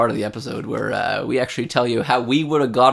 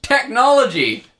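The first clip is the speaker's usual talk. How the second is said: big sponsor reveal, drawn out and high; ad announcer; 11 semitones higher, 4 dB louder than usual. Acted.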